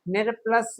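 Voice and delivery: ordinary talk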